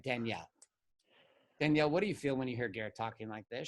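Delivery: soft, hushed therapist voice; little Zen lady. Retold or imitated. Therapist turns to the wife after the outburst, calm and Zen. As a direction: ask it gently, very quietly. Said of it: "She was like little Zen lady".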